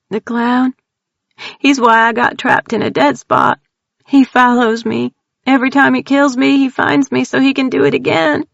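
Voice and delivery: put-on scared country girl blurting out her terror; frightened Texan woman. Tildy tells strangers about the clown, high frightened Texan voice.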